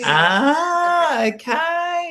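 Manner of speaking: high, drawn-out Australian "ah, okay" full of vowels; Australian accent. Retold or imitated. Mimicking a guest's reply in an Australian accent. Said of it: "this is definitely Australian".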